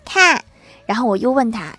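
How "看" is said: put-on high, short child voice; imitated child, high one-word answer; acted child's reply. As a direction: answer like an eager little kid, pitched up. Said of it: "郭晓霞说".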